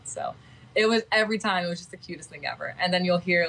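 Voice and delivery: usual speaking voice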